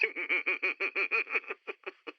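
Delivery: drawn-out gloating villain laugh; soft triumphant villain cackle; evil laugh. Acted. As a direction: savor the victory with a laugh. Megalomaniac gloats over captive.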